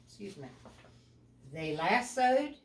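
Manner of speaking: ordinary delivery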